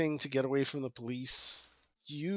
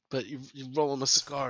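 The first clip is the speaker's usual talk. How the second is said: normal talking voice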